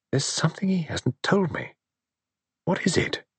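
wife's uneasy inner whisper about her husband; whispered thought. Acted. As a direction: breathe the worry as a secret thought. Theo's anxious inner thought.

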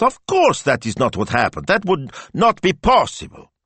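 high, emphatic protest; indignant. A narrator's reading. Young man rebuts the assistant's fear, indignant. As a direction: deny it outright, outraged.

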